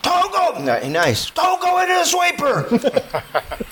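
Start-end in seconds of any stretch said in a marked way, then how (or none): 0.0-0.6 very high, strained shout; screamed warning. Retold or imitated. Buddy who missed the rope warning the rafter, screamed shout. 1.3-3.6 high, drawn-out distant scream; screamed warning. Retold or imitated. Buddy warning the rafter off the sweeper, screamed warning.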